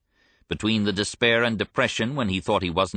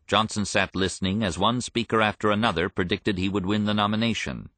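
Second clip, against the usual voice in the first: ordinary talk, nothing marked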